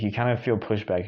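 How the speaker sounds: ordinary talk, nothing marked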